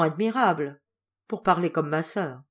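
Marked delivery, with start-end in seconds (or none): none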